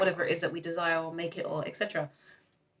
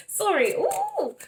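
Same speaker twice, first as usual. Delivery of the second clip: very high squealing laugh and ooh; can't contain the thrill; real giddy squeal, much higher; giddy excitement; much slower than usual. Real, not acted. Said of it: "I need to calm down".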